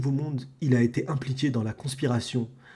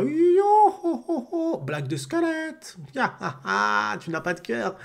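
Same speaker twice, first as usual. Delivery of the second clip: very high, slow yohoho laugh; skeleton Brook laugh; 9 semitones higher, slower than usual. Acted. Streamer voicing the skeleton's joke with a very high yohoho laugh. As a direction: cackle high like a joking skeleton.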